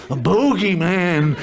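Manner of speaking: low, slow and spooky; low spooky storyteller voice; spooky bogeyman voice. Pretend. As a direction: say it like a scary bedtime tale.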